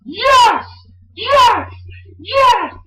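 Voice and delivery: high, shouted catchphrase bursts; Daniel Bryan. Retold or imitated. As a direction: yell each one high, imitating a wrestler mid-kick. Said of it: "He was like".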